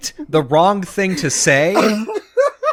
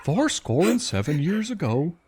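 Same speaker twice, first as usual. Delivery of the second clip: Hank Hill voice; 7 dB quieter than usual. Pretend. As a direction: quote Lincoln as a laughing, softer Hank Hill. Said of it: "He sounds like Hank Hill".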